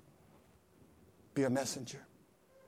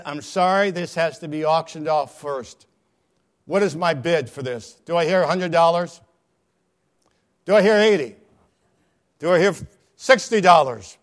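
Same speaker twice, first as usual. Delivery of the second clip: auctioneer; 5 semitones higher, 11 dB louder than usual. Acted. Auctioneer calling bids.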